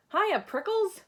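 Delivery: high loud cheery greeting; shouted. Acted. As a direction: shout hello while dashing by. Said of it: "she shouted".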